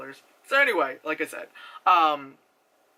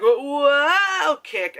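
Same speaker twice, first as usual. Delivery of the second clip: mock actress; 8 semitones higher, 5 dB louder than usual.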